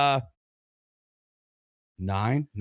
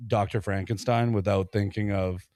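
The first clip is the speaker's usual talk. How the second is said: ordinary talk, nothing marked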